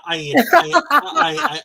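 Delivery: laughing mid-quote. Real, not acted.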